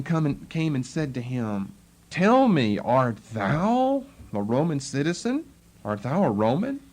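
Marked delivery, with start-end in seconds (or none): 2.1-6.8 "thou" stretched and swooping up in a question; stress on THOU. Shown as a demonstration. Chief captain questioning Paul, THOU stressed. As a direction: lean hard on "thou", ending on a rise. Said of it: "the emphasis is on the word thou".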